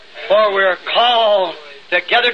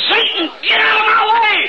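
1 defiant shout; 10 semitones higher than usual. Retold or imitated. Defying Satan in retold thought.

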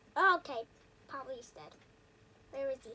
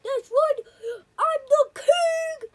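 triumphant shout; 7 semitones higher, 10 dB louder, much slower than usual. Real, not acted. Gloating from the roof.

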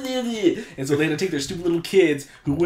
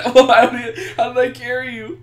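higher, laughter in the voice; laughing; 10 semitones higher, 7 dB louder than usual. Real, not acted. Player wonders aloud how he carried a teammate, laughing. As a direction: puzzle over it while giggling.

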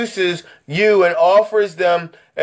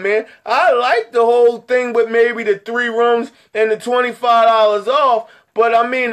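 higher, protesting imitation of a follower; doubting viewer; 5 semitones higher than usual. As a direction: push back like a skeptical buddy. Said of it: "I know what you guys are saying".

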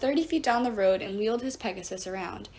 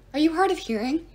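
sharp, exasperated jab; impatient scold; 6 semitones higher, 5 dB louder than usual. A narrator's reading. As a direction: Bacchus snaps at Percy for repeating him, impatient. God scolds Percy.

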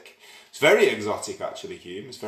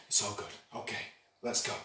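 breathy whispered go-ahead; hushed cue to begin the music; 8 dB quieter, faster than usual. Real, not acted. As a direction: whisper it as the track starts. Quietly starting the music.

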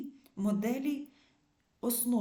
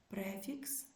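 ordinary talk

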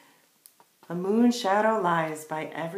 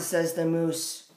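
ordinary delivery, nothing marked

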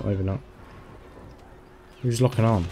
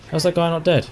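disbelieving shout; 6 semitones higher, faster than usual. Real, not acted. Disbelief at surviving enemy.

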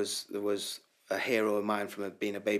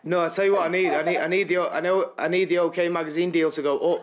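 louder, higher cheeky plea with a laugh; excited joking pitch; 8 semitones higher, 6 dB louder than usual. Real, not acted. Winner cheekily asks the host to help his magazine deal, loud and laughing. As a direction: sell the cheeky idea, buzzing.

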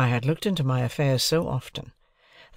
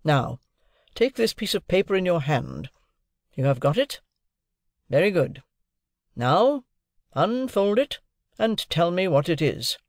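high, deliberate instructions; overbearing lawyer; 8 semitones higher than usual. A narrator's reading. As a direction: take charge like a cross-examiner. Lawyer figure instructs his ward.

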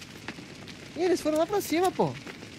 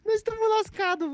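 panicked; 14 semitones higher, slower than usual. Real, not acted. Panicked co-op gamers.

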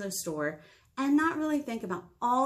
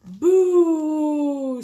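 loud, high, long-held boo; big spooky boo; 6 semitones higher, 12 dB louder than usual. Acted. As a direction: boo with full force to scare. Jack-o'-lantern scares at night.

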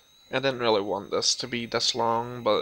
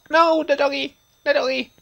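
much higher, loud panicked cry; panicked shout; 13 semitones higher, 8 dB louder than usual. Real, not acted. Gamer seeing the dog hurt, shouting high in panic.

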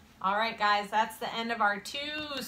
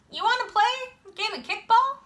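high, loud, quick question; shouted invitation; 12 semitones higher, 5 dB louder, faster than usual. A narrator's reading. Kid inviting a friend into the game, quick shouted call.

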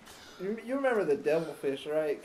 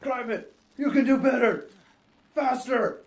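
barking gym teacher orders; 6 semitones higher, 7 dB louder than usual. Acted. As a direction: push like a strict coach. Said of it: "Sound like my gym teacher".

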